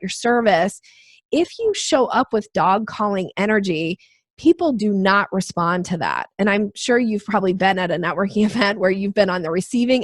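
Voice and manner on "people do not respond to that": ordinary delivery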